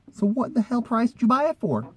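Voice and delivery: high incredulous question; can't believe the price; disbelief. Real, not acted. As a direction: pitch jumps high in disbelief. Disbelief at the debt.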